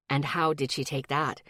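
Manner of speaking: low, soft and controlled; steady, careful and calm. A narrator's reading. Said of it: "that controlled calm".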